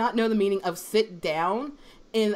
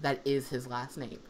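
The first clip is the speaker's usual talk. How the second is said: deadpan; 8 semitones lower, 8 dB quieter than usual. Retold or imitated. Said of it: "with dead, like, just looking at him".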